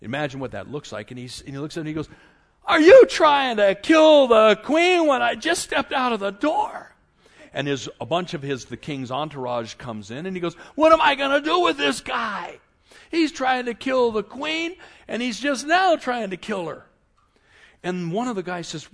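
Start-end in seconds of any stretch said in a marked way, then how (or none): 2.7-6.9 loud, high indignant outburst; outraged accusation. Acted. King walking back in on Haman and Esther, outraged. 10.8-16.8 high, loud indignant appeal; exasperated rant. Acted. King ranting to his entourage about Haman, exasperated.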